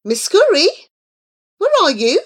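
loud, high searching call. A narrator's reading. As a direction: shout for a lost companion. Calling again, no answer.

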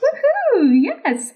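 high, delighted whoop; real joyful cheer; excited cheer. Real, not acted. Host cheers the guest's thanks.

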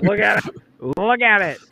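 high, loud mock-proud call; showing off proudly. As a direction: show it off loudly to everyone. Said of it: "and just be like".

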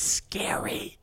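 slow, drawn-out whisper; spooked whisper. Acted. Warning about angry girls, slow scared whisper.

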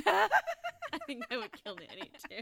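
loud, high, slowed by laughter; helpless laughter through the words; laughing. Real, not acted. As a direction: crack up while saying it. Repeats the answer while cracking up.

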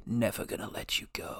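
breathless whispered subtitle; whispering. Acted. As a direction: whisper the sequel title dramatically. Mock movie subtitle.

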